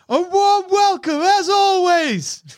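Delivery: high, slow shouted reply; yelling soldier. Acted. Soldier turning round to answer the joke, shouting. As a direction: holler it back over the gunfire. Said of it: "The soldier laughs, turns around".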